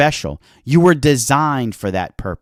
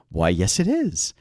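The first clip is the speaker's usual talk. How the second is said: coy; 5 semitones lower, 7 dB quieter than usual. Retold or imitated. Coy reply to a compliment.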